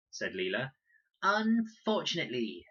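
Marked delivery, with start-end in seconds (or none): 1.2-2.7 put-on higher, louder small-boy echo; echoing little boy. A narrator's reading. Small boy echoing the older girl, higher and louder. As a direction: parrot the word like a little kid. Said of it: "echoed Max".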